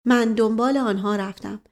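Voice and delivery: ordinary delivery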